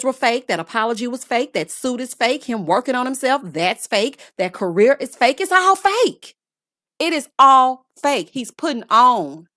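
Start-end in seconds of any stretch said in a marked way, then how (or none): 5.4-8.3 louder, higher, slowing to punch each word; emphatic shout. Real, not acted. Host ranting to viewers that it's all fake, loud.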